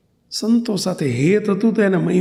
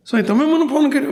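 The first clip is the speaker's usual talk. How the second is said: put-on raised, puzzled young man's voice on the phone; surprised young man; 8 semitones higher, faster than usual. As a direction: ask it like a startled young caller.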